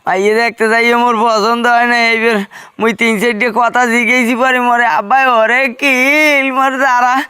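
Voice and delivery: tearful, droning wail; crying. Acted. Beaten son telling a friend about the bride viewing, crying. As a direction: sob through the tale of woe. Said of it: "কান্দে কান্দে যাও কই?".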